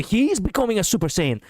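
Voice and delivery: high, excited exclamation; excited outburst. Real, not acted. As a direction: blurt it out as a thrilled fan. Excited outburst at character's transformation.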